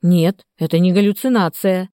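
usual speaking voice

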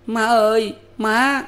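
put-on high, loud child's cry for his mother; boy calling out to mother. A narrator's reading. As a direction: call out high like a desperate little boy. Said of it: "mà kêu".

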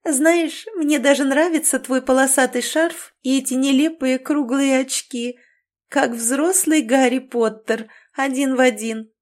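high, loud slurring tipsy woman; giggly drunk. A narrator's reading. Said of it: "язык у неё уже сильно заплетался".

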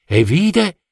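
plain reading voice

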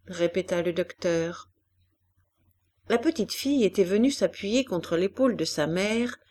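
plain reading voice

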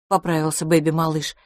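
plain reading voice

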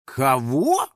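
rising, puzzled question; surprised. Acted. Finik hearing an unknown name, surprised. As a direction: ask in astonishment, sliding up. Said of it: "удивился Финик".

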